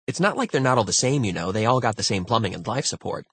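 excitable, hurried protest; insistent crewmate arguing. Acted. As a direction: rattle off the argument, pressing the point. Voices Sengupta in story.

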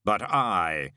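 slow, echoing, otherworldly voice; deep calm of an immortal; voice of Death. A narrator's reading. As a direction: speak as the ancient angel of death, unhurried. Death speaks.

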